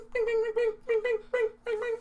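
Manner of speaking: high sung bings; beat imitation. Shown as a demonstration. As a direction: mimic the beat's chime.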